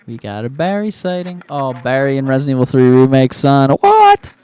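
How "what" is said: much higher, loud startled "What?"; surprised shout. Real, not acted. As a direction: blurt it out in disbelief. Hears strange game audio.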